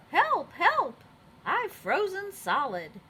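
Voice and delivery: high, loud mock cry for help; playful pretend alarm, calling across the snow. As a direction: Elmer hamming up a rescue call. Elmer calls for help.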